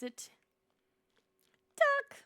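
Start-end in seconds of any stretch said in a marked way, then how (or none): 1.8-2.2 high, loud shout of the name; delighted yell; excited call. Real, not acted. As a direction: call out in sudden joy.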